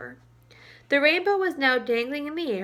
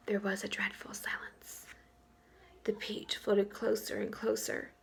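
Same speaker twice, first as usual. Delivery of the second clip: whisper; 9 dB quieter than usual. A narrator's reading. Suspenseful moment in the story.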